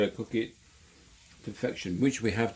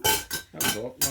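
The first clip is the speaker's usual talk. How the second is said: pained squeal; much slower than usual. Real, not acted. Just burnt finger.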